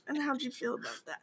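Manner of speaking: soft mock-seductive murmur; imitated come-on. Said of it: "all of a sudden horny or something".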